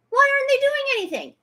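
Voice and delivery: high, fast yell of frustration; outraged yell. Real, not acted. Outraged repeated demand.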